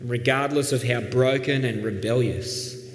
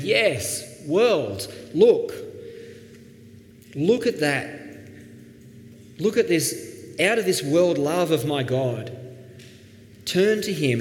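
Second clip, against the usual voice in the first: joyful proclaiming; 4 semitones higher than usual. Pretend. Jonah on the mountaintop calling the world to God, exultant. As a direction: throw it out wide like shouting praise from a hilltop. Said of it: "he could have been sitting on the mountaintop saying".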